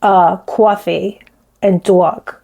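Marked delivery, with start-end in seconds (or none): 0.4-1.2 slow Bronx "cawfee"; old Bronx accent. Shown as a demonstration. Vlogger showing how she used to talk, Bronx accent. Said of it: "I used to definitely say". 1.8-2.3 Bronx "dawg", rounded vowel; old Bronx accent. Shown as a demonstration. Vlogger showing her former speech, Bronx accent. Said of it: "I used to definitely say".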